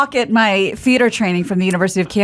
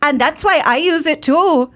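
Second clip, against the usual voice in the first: Irish lilt, a bit higher; Irish accent; 5 semitones higher than usual. Shown as a demonstration. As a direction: ad line in a light Irish brogue. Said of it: "Let's hear it, [name]".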